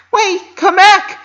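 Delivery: very high, loud, drawn-out call. A narrator's reading. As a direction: call after a friend storming off. Calling after friend.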